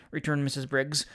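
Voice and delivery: plain reading voice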